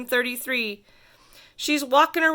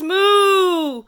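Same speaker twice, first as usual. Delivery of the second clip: long, higher, louder moo; cow moo; 6 semitones higher, 6 dB louder than usual. Acted. The cow looks at the kid and moos, loud drawn-out moo. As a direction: moo out like a big cow in the road. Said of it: "and the cow says".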